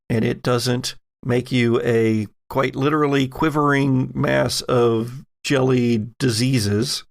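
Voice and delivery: normal talking voice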